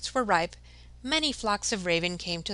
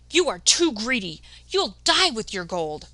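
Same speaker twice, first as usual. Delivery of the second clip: loud, higher furious scolding; angry cry; 4 semitones higher, 8 dB louder than usual. Acted. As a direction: raven cries out its curse in rage. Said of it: "angrily cried".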